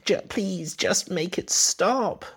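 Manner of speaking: high, slow desperate begging; pleading wife. Retold or imitated. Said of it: "Sam always calls me Chazzy".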